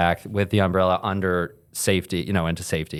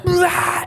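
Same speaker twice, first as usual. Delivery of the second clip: very high squealed "blah!"; mock startled shriek; bitchy noise. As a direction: let out a silly high-pitched yelp. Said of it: "one of those, like, bitchy noises".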